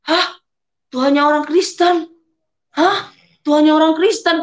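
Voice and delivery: high, startled double take; stunned at recognising Jesus' picture; shocked disbelief. Retold or imitated. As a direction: gasp out the question in disbelief. Shocked at seeing Jesus' image.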